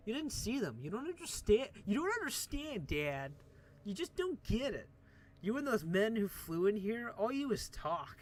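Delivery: high, insistent pleading at Dad; distraught teenage-style outburst, acted; upset daughter. Upset daughter confronting her father.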